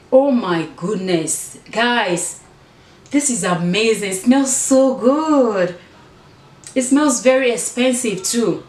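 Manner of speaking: thrilled and slow, rising up; delighted. Real, not acted. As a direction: gush over a lovely scent. First spray of new perfume.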